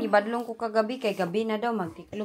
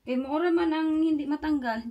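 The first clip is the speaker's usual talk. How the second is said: ordinary delivery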